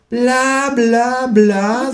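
high, mocking bla bla; mock the speaker in a high voice; bored kangaroo. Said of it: "sagt das Känguru zu Hause".

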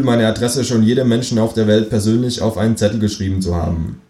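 ordinary delivery

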